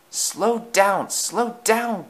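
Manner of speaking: alarmed cry. A narrator's reading. Coaches begging a speeding engine to slow, alarmed cry. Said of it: "cried Annie and Clarabel".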